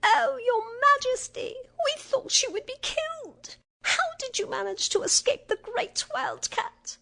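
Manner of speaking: high, squeaky little mouse; exclaiming mice. A narrator's reading. Mice greet their queen after her escape, squeaky exclaiming. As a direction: squeak out your astonishment. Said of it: "they exclaimed".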